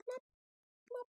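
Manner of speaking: soft plop sounds; tear drops. A narrator's reading. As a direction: drip two little plops. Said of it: "a large tear welled up".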